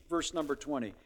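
normal talking voice

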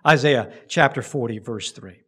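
ordinary delivery

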